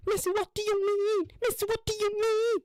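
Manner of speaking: high falsetto mock voice; mock questioner. Someone asking Missy, mocking, in a high falsetto. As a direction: squeak the question in a put-on voice.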